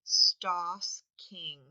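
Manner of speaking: "Stosking" in two beats, stos and king; "Stosking" split evenly between stos and king; syllable by syllable. Shown as a demonstration. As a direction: pound out "Stosking" loudly, one syllable at a time. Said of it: "pound that out to see how many syllables".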